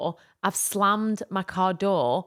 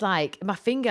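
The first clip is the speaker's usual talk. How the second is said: normal talking voice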